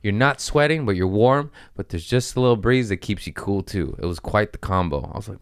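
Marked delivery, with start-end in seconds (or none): none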